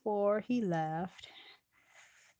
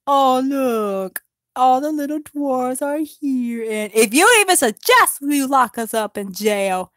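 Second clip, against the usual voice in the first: loud, higher mocking elf king; 5 semitones higher, 10 dB louder than usual. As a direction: sneer at the dwarves loudly. Thranduil voiced mocking dwarves.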